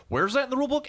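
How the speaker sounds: high, whiny imitation of a complainer; mock whining. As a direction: mimic a player whining and arguing. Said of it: "they sit there and whine and argue".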